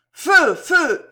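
high, loud military shout; yelled firing order. A narrator's reading. Officer commanding his soldiers, yelled order. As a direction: bark the order to shoot. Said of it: "hurla la voix".

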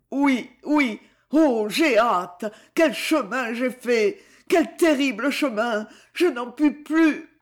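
put-on a dying young man stammering, at the end of his strength; exhausted stammer. A narrator's reading. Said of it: "Il balbutia".

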